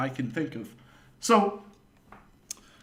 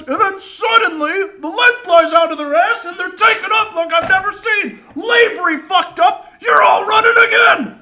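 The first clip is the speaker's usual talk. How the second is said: high, loud furious coach rant, building to a scream; 13 dB louder than usual. Retold or imitated. Coach ranting at the whole team, high furious yelling. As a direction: yell it, getting angrier to the end.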